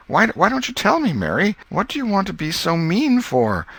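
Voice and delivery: high pleading whine; a frustrated boy begging for the answer; whining boy. Acted. Tom whining at Mary to tell him, very high.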